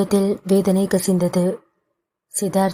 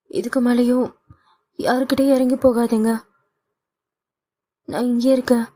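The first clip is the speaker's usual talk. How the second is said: put-on higher, mildly tearful young woman; pleading daughter; 4 semitones higher than usual. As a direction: plead with mother, a touch of tears.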